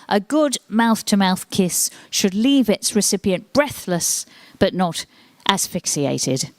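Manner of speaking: slow, deliberate read with a pause before asphyxiated; mock solemn reading. Comic quoting The Joy of Sex to the audience, slow and solemn. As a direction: read it out slowly, landing hard on asphyxiated. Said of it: "it says".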